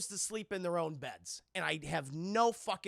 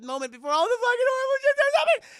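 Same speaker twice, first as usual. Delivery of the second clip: much higher, much louder hammed-up wail; mock shriek; 13 dB louder, faster than usual. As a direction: blow up the end of the quote into a shriek. Mock wife quote erupts.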